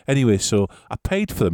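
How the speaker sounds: ordinary delivery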